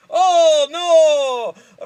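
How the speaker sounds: loud, high wail of dismay; big melodramatic movie panic; mock dismay. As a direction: cry out in hammed-up alarm. Acted alarm in film remake.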